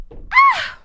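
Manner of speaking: sudden shrill cry out; short startled scream; high-pitched startled yelp. Real, not acted. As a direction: yelp in surprise.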